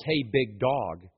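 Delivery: low, soft, drawn-out mock greeting; mock casual greeting. Preacher quoting how nobody should address God, mock casual. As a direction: drop down and stretch out the imitated greeting.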